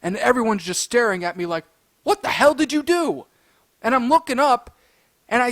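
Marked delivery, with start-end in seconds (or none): 2.0-3.3 shocked demand. Retold or imitated. Stunned onlookers confront him.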